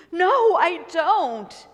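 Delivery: high, loud, very slow frightened no; scared whimpering answer. Acted. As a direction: drag it out like a scared child.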